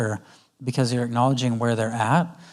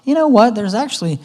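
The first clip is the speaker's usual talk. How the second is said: upbeat opener; 8 semitones higher, 5 dB louder than usual. Shown as a demonstration. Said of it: "that's when you go".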